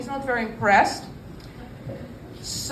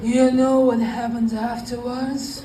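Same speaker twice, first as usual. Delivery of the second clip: put-on Sicilian accent; slower than usual. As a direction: lean into a hint of a Sicilian godfather accent. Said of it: "Italian slash Sicilian accent".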